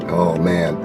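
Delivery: usual speaking voice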